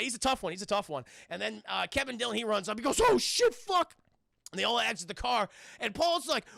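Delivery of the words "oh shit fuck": high, loud mock panic; hammed-up horrified outburst; shocked cursing. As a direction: yelp as if witnessing a crash. Acting Kevin's shock at accident.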